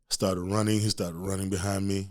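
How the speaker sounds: ordinary talk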